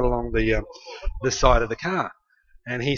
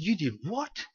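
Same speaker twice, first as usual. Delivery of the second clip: soft, incredulous question rising high; mock disbelief; 4 semitones higher, 10 dB quieter, slower than usual. Sister to brother who damaged the car, incredulous. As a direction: voice climbing in hushed disbelief. Acted sister's shocked reaction.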